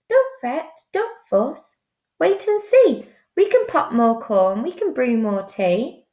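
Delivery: high, soothing little mouse squeak; squeaky mouse. Acted. Mouse comforts the sad bear, high reassuring squeak. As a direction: comfort him in a tiny squeak. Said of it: "Mouse squeaks".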